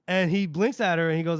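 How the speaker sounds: ordinary delivery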